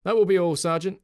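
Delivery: loud, high clipped dismissal; curt dismissal. A narrator's reading. Officer curtly dismissing his sergeant, loud. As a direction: dismiss him with finality.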